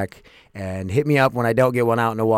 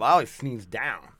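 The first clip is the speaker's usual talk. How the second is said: usual speaking voice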